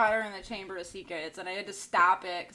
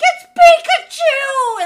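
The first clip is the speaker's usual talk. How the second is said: excited squeal; 19 dB louder, much slower than usual. Retold or imitated. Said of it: "They were like".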